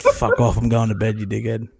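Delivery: loud, mock-annoyed brush-off; retold telling-off. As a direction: tell a mate off loudly, half in fun. Retells telling friend off, amused.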